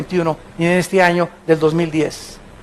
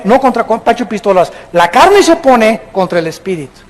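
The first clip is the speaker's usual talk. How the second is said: ordinary delivery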